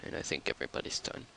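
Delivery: ordinary delivery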